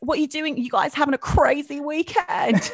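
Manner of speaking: high, loud DJ banter, half laughing; chatty radio presenter. Acted. Guest mimicking presenters chatting to listeners, South London radio voice. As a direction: chat up listeners with breezy hype. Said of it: "What do they say? They're like".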